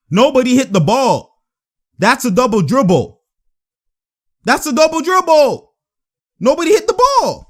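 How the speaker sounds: indignant fan appeal; shouted protest. Real, not acted. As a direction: yell out the violation. Yells violation at the refs.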